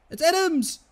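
high, loud burst; real thrilled cry; excited goal call. Real, not acted. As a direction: shout the scorer's name. Calling a goal in game.

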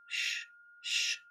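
soft, breathy hush; whispered shh sound; shushing. A narrator's reading. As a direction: shush it like telling a secret. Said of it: "says the fish".

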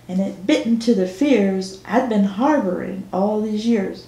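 ordinary delivery

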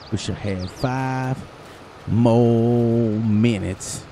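very slow, stretched words; drawn out. Real, not acted. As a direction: stretch it out slowly. Drawn-out wish.

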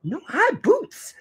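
put-on high chirpy little girl; a toddler correcting her father. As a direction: chirp it like a tiny child. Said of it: "said the little girl".

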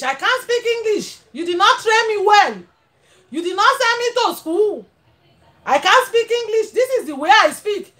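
pretend sister. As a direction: play the sister who never learned English.

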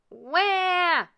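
put-on high, loud baby ghost wail; baby's wail. A narrator's reading. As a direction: yell like a hungry baby. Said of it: "yelled the baby ghost".

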